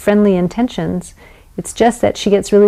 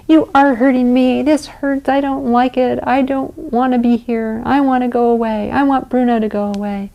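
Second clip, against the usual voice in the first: put-on upset child; 5 semitones higher than usual. Shown as a demonstration. Child voiced protesting.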